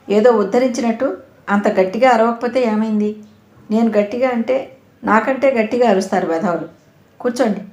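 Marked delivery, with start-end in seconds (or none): none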